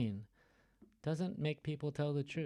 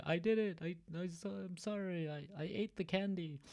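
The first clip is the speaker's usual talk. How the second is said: higher, pleading, sorry confession; mock confession; 7 semitones higher than usual. Teacher acting out a false confession for class, pleading. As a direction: plead guilty like a caught child.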